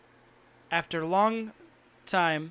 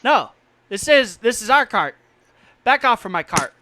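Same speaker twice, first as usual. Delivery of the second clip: lightly voiced challenge, louder than narration; confronting cart owner; 3 semitones higher, 9 dB louder than usual. Son confronting a stranger at his cart, accusingly. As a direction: warn off a stranger nosing around.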